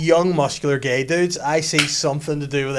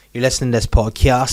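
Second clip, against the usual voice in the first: louder mocking quote of podcast people; irritating podcast person; 9 dB louder than usual. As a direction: mimic someone smugly saying podcast. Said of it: "I hate when people say".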